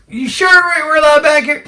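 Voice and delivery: high, loud nervous question; alarmed shout. Retold or imitated. Passenger yelling worried on private road. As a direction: shout it out in worry.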